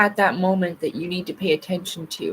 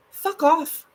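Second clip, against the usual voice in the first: exasperated; 9 semitones higher than usual. Real, not acted. Cursing at a solar flare.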